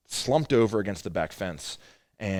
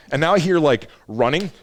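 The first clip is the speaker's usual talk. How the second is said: louder, higher, rushing through the chaos; excited retelling; 7 semitones higher, 8 dB louder than usual. Retold or imitated. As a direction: relive the panic as you tell it. Retelling chaos heard on phone.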